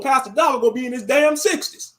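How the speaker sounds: normal talking voice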